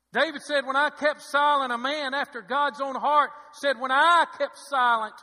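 loud, high pulpit cry; impassioned scripture preaching. Acted. Preacher quoting David on keeping silent, loud impassioned preaching. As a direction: throw each clause out forcefully, with pauses.

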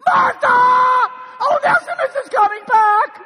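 high, loud cry near tears. Acted. Philemon crying out to Martha that Onesimus is returning. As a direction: wail the news out. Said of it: "let's act this thing out".

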